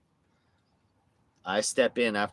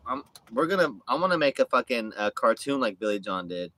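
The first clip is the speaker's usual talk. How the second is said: normal talking voice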